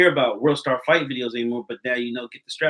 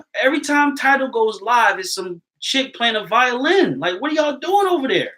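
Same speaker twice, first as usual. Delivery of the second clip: high-pitched, raised-voice disbelief; exasperated complaint; 8 semitones higher, 4 dB louder than usual. Real, not acted. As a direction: complain incredulously, voice climbing high. Exasperated complaint about Tidal.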